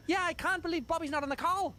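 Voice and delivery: high, light voice for the Irish host; a hint of the Irish interviewer; imitating the Irishman. Mimicking the Irish interviewer complaining on the call, high pitched. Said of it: "the Irishman goes".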